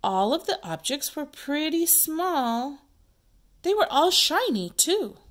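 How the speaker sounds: lively read-aloud character line; acted play character. Teacher acting out play.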